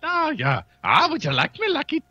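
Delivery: high, swooping mock-Irish accent; bad Irish accent. Shown as a demonstration. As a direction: ham up a failed Irish lilt. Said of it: "the Irish in me always ends up".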